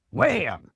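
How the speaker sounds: sudden high, loud "wham"; loud storyteller's sound effect; imitated impact. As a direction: punch it out like a sudden jolt. Acting out the sudden adjustment.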